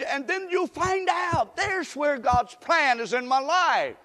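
slow, high, loud preaching cry; preaching shout. Acted. Preacher urging the church to find God's plan, shouting. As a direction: lift it to a shout.

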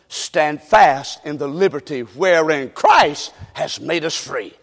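slow, low, hushed recitation; solemn scripture reading. Acted. Preacher reading Galatians to the church, hushed and solemn. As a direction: let the verse settle. Quotes scripture slowly and softly.

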